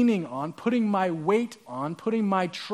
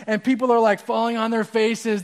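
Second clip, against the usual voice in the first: normal talking voice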